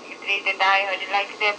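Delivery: normal talking voice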